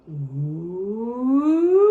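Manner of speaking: a gliding ooh that climbs from low to high; voice rising low to high. Shown as a demonstration. Teacher leads kids tracing the turkey's flight, rising ooh. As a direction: trace the turkey flying up into the tree. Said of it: "Let's use our voices to trace his path".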